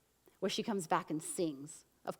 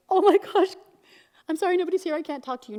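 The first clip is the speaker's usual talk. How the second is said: high, loud flustered excuse; hammed-up alarm, pitched way up; mock panic; 10 semitones higher, 11 dB louder, faster than usual. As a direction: play a flustered woman turning someone away. Acted panicked woman, then denied.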